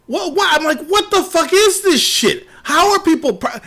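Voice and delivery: can't believe what he's seeing; outraged disbelief. Retold or imitated.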